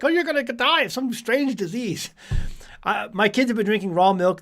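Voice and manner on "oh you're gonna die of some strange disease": lightly hammed-up worried voice, a bit higher; mock alarmed skeptic. Imitating skeptical parents about raw milk, mock alarm. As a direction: mimic a nervous raw-milk doubter, half joking. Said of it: "They're like".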